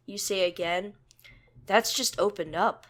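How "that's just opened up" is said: ordinary delivery, nothing marked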